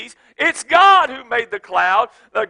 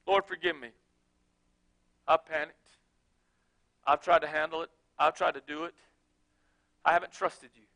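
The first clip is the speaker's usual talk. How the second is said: softer, lower humble prayer; quiet confession; 7 semitones lower, 10 dB quieter, faster than usual. Pretend. Believer confessing to the Lord, soft and humble. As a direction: pray it like a penitent child.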